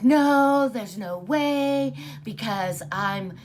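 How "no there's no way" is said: loud high no, then slow denial; disbelief. Retold or imitated. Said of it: "And I was like".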